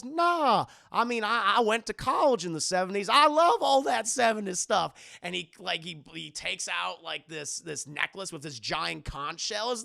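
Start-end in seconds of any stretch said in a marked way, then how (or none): 0.0-4.9 high, loud, gushing cartoon dad; enthusiastic Goofy. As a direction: gush with goofy delight. Voices Goofy gushing about seventies.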